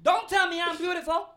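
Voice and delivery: high, laughing mock caller; mocking the old lady. Agent imitating a caller offended by the greeting, high and laughing. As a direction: whine it through laughter like an offended señora. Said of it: "te decía así como".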